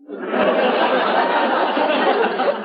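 breathy high cackle at his own joke; wheezy laugh. Acted.

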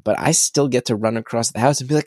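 ordinary talk, nothing marked

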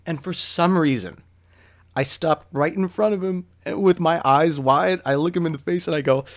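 ordinary delivery

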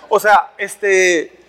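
normal talking voice

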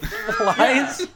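ordinary talk

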